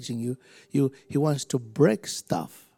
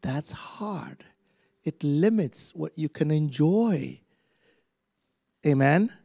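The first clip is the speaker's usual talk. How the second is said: usual speaking voice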